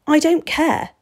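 put-on high, loud, stubborn boy. A narrator's reading. Boy refusing to be put off by the vampire, stubborn. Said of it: "Steve said stubbornly".

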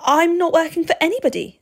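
high, loud, quick protest; insistent. A narrator's reading. Boy denying to the vampire that he was sent, insistent. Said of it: "Steve insisted".

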